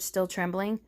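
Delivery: ordinary delivery, nothing marked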